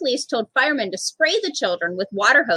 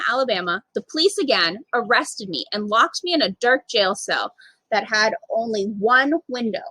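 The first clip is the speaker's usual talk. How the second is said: ordinary delivery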